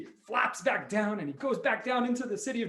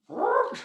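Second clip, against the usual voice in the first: short, high, loud alarmed squawk; rooster squawk; 6 dB louder than usual. Acted. Rooster told it will be sat on, alarmed squawk.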